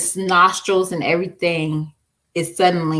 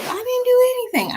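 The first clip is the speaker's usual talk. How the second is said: put-on much higher, whiny little-girl voice; imitating her daughter. Mom imitates her daughter denying everything in a high whine. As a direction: play the daughter protesting her innocence. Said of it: "When she says".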